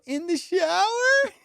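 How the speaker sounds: very high, drawn-out echo question; incredulous. Retold or imitated. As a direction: imitate her in slow, high disbelief. Imitates her incredulous echo.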